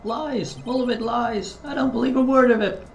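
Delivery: high, furious shouting denial; angry outburst. Acted. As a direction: rage at the accusation, pitched high. Defendant outburst in court.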